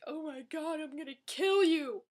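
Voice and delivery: high, rising mock fury; mock outraged fan. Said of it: "people would be like".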